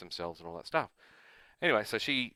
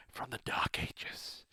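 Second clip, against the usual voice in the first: mock whisper; 3 semitones higher, 8 dB quieter, much slower than usual. Joking about old private stories.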